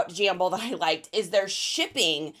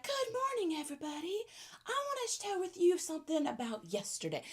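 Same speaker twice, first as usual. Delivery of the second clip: soft, much higher, gentle imitation of D's little accent; imitating D's accent; 6 semitones higher, 11 dB quieter than usual. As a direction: coo it like a cosy morning video host with D's accent. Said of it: "Just that little accent".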